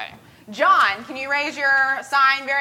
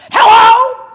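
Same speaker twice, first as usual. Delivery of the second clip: booming, high-pitched phone-answering yell; imitating a loud father on his cell phone; cell phone volume; 11 semitones higher, 14 dB louder, slower than usual. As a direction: shout into the phone, far too loud. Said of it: "he has a cell phone volume for his voice".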